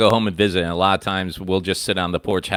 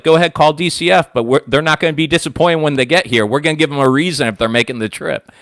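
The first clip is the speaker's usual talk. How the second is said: louder, higher mom taunting; mock threatening mom; 7 semitones higher, 5 dB louder than usual. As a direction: dare the kids like a fed-up mother.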